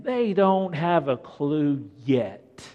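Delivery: loud, high, driving sermon voice; preaching at full force. Acted. Preacher rousing the church about the disciples, loud and high. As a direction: lift it up and preach hard to the room.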